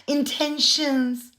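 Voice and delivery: high, put-on airy-fairy voice, slowing on intentions; mock her self-righteous excuses in a simpering voice; sarcastic high-pitched parody of the influencer; mocking impression of her. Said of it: "She said".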